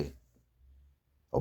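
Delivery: a tiny unvoiced aside; whispered "अहो". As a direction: whisper it breathily. Sattu speaks to Fakira.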